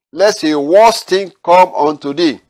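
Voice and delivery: high, loud, slow preaching warning; solemn warning. Acted. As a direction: proclaim it as a grave command.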